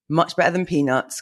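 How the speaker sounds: usual speaking voice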